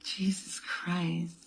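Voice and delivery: slow breathy whisper of awe. Pretend. Turned-on caller on phone sex call.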